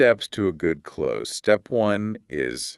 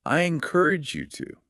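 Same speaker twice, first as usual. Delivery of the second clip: high, bright model phrase; 11 semitones higher than usual. Shown as a demonstration. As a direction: clear teacher model pitched up. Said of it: "You can say".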